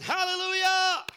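very high, loud, held-out whoop; playful full-voiced hallelujah yell; joyful shout. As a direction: holler it across the store aisles. Himself shouting from the third aisle.

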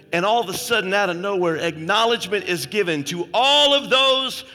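loud, high preaching, pressing the congregation to step forward; preaching at full force. Acted. Preacher to congregation, about the coming throne, loud fervent preaching. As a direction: raise the church to its feet with a shout.

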